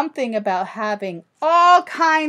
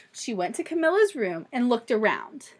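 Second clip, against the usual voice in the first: ordinary delivery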